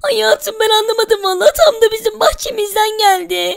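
soft high-voiced wife, puzzled; a put-on wife's voice, hushed. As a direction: voice the wife, gentle and unsure.

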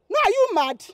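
sharp scolding retort, loud; angry retort. Acted. Girl snapping at the pestering man, angry. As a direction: throw the question back at him, angry.

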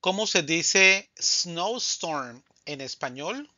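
ordinary talk, nothing marked

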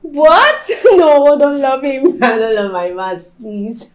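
laughing. Acted. Laughing denial of loving him.

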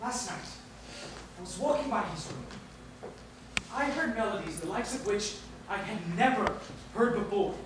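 soft, low, halting account; hushed awe. Acted. Secretary recalls melodies overheard at night, hushed. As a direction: confide it quietly, pausing in wonder.

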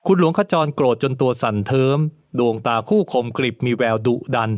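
usual speaking voice

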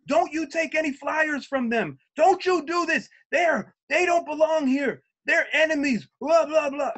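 high, loud mock scolding; imitating the rep ranting at workers not to take flyers; retold angry rant. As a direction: bark orders like the furious rep. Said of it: "and he's like".

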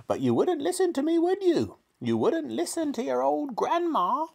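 put-on very high, screechy old-woman voice; grandma boasting. A narrator's reading. Grandma boasting to George of magic powers, squawking. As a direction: crow triumphantly in a piercing granny squawk.

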